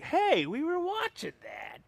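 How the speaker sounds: very high, drawn-out mock complaint; hammed-up high-pitched protest; protesting viewers. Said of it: "people are like".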